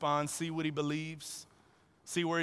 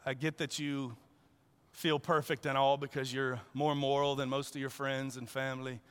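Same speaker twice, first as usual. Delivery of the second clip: gentle Jesus; 4 semitones lower than usual. Pretend. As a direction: lovingly invite him to let go. Preacher voices Jesus, gently.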